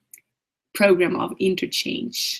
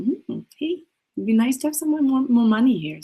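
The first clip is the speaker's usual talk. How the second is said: higher, light flirty tease; flirty and playful; 6 semitones higher than usual. Pretend. Coach flirting with money as if it were a lover, playful and light. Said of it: "hey, a bit flirty".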